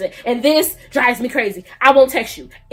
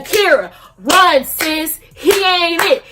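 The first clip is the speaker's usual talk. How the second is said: loud, punchy mock alarm; urgent warning; 3 semitones higher, 6 dB louder, slower than usual. Red flags warning Akira about a catfish, hammed-up urgent shout. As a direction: play the red flags yelling at her to flee.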